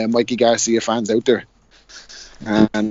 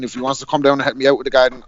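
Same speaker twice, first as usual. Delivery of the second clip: ordinary talk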